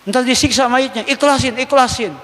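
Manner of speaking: mock know-it-all. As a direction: parody a self-righteous busybody. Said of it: "Kayak orang bener".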